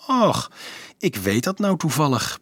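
usual speaking voice

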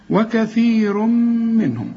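high, slow hum joining وكثير منهم; merged nasal meem. Shown as a demonstration. Teacher recites the verse, long merged hum in وكثير منهم. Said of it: "الميم من حروف الإدغام".

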